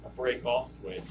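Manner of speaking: usual speaking voice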